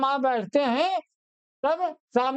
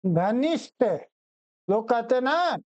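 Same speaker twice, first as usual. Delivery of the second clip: normal talking voice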